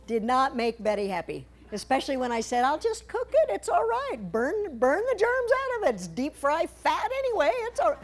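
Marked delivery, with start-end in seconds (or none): 2.6-8.0 much higher, carefree put-on voice; breezy mock self. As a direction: brush off the dropped dough like nothing happened. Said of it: "I didn't say it like that".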